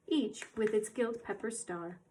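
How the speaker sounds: usual speaking voice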